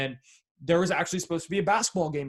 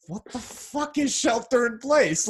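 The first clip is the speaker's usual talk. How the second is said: baffled; 8 semitones higher, 3 dB louder, slower than usual. Retold or imitated. Retells confusion at new term.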